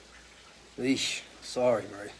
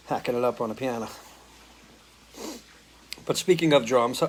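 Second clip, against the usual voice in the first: normal talking voice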